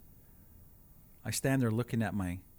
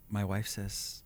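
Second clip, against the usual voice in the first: ordinary delivery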